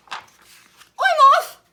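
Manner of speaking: sudden high shout; very loud, high frog exit line; escaping yell. Acted. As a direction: yell it and bolt. Frog flees from the crocodile.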